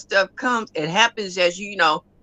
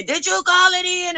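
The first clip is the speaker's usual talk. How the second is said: impatient coworker; 11 semitones higher than usual. Retold or imitated. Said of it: "they looking at me".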